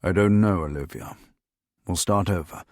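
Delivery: understated weariness, dropping low; weary. A narrator's reading. Winston to his colleague in the crisis suite, weary. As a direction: answer with tired resignation. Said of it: "let out a weary sigh".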